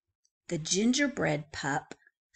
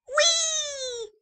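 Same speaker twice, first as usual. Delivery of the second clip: high gleeful squeal; excited squeal; 4 dB louder than usual. Acted. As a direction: squeal with delight like sliding down. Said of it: "said the gingerbread pup".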